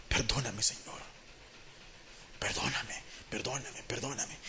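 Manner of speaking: very soft, low murmured pleading; hushed plea for forgiveness. Acted. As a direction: beg God's pardon under your breath. Pleading prayer for mercy and forgiveness. (súplica de perdón en voz muy baja)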